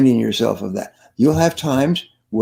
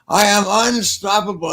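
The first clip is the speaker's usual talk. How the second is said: high, rousing rallying cry; imitated crowd shout; 8 semitones higher than usual. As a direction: belt it out like a whole audience. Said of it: "the whole audience would shout".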